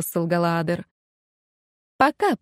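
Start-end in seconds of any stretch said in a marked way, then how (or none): 2.0-2.4 «Пока» said alone, pitched high and pointed; stressed word. A narrator's reading. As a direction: lean hard on «Пока» as a warning. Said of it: "подчеркнула графиня".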